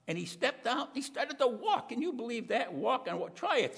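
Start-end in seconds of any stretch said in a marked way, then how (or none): none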